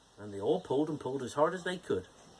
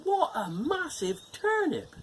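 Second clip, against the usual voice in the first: amazed; 13 semitones higher, slower than usual. Acted. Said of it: "she said".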